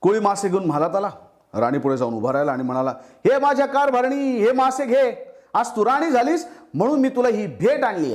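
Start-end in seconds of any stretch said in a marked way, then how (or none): 3.0-8.1 eager humble fisherman. Acted. Fisherman presents fish to his queen wife, high and eager. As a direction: lift the voice up to present a gift.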